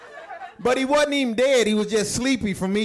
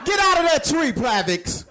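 yelling out the window; 3 semitones higher than usual. Retold or imitated. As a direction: holler names out a window at the kids. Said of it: "She always yelling at her out, out the window".

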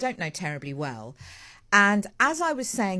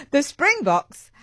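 louder and higher, "Springboks" said slowly and clearly with a laugh; laughing correction; 7 semitones higher, 9 dB louder, much slower than usual. Real, not acted. Presenter fixing her slip on air, laughing. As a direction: correct the name through a laugh. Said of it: "Oh, it was going so well".

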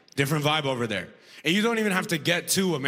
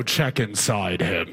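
gruff guard; 8 semitones lower, slower than usual. Retold or imitated. Guard behind open door.

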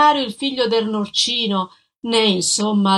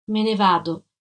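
listless. Acted. Ex-convict to his sister's husband, listless and deflated. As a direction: say "Me ne vado" limp and defeated. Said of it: "disse lui, moscio".

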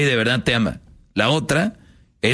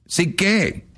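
put-on demanding girlfriend; 8 semitones higher than usual. Woman demands, acted.

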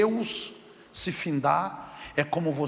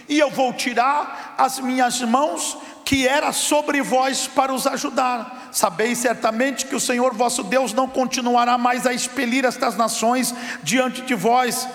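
loud, high solemn proclamation; voice of God; 6 semitones higher, 3 dB louder than usual. Acted. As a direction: declare it as God's warning. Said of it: "Deus tá dizendo assim". (Deus advertindo, proclamação solene e forte)

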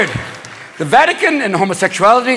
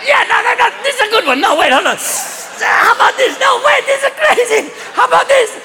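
very high, squealing excitement; stoned brainstorming in a squeaky high voice; hysterically giddy cardinal; 14 semitones higher than usual. Acted. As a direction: pitch it as the wildest idea ever. Stoned cardinals pitching a crazy idea.

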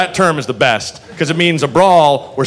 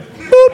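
high boop; 5 dB louder than usual. Pretend. Playful sound effect.

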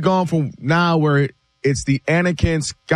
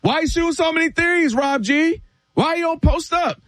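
much higher, needling mock complaint; mocking critic; 11 semitones higher than usual. Fans turning on the young star, mock nagging. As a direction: whine it like a nagging fan. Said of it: "What's the first thing they say?".

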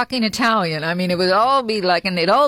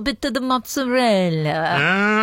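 mock Italian accent. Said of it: "Yeah, a little bit".

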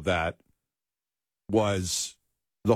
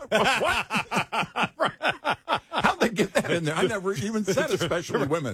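higher, laughing mock outrage; laughing disbelief; 9 semitones higher than usual. Real, not acted. Mock outrage at invented quote.

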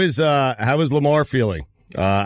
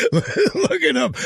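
laughing; 10 semitones higher, slower than usual. Real, not acted. Host laughing at guest's answer.

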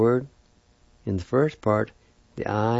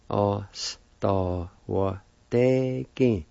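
oss da var degen drawn out very slowly, falling on degen; much slower than usual. Shown as a demonstration. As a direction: stretch it out slowly for learners. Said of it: "And together".

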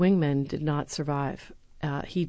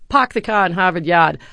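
imitating Boston accent; 9 dB louder than usual. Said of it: "that guy's Boston accent".

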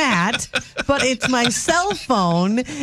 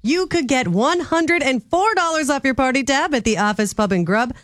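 promo read; 5 semitones higher than usual. Acted.

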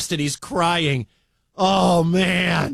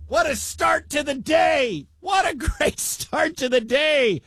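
higher, laughing, buzzing with joy; excited shout; 7 semitones higher than usual. Real, not acted. Announcer wrapping up the wild finish for listeners, excited higher shout. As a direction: still riding the thrill of the win.

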